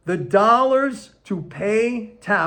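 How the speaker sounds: slow and deliberate so they get it; very slowly. Real, not acted. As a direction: spell it out word by word. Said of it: "say this very slowly".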